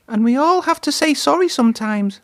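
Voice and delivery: high, cheerful smiling voice; gentle friendly engine; smiling Percy. A narrator's reading. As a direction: say it with a warm smile. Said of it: "smiled Percy".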